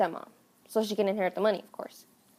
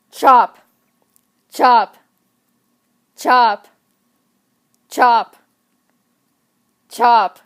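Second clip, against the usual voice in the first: loud punchy chop sound effects; 2 semitones higher, 10 dB louder, much slower than usual. Acted. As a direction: hit each chop hard. Acting out axe blows.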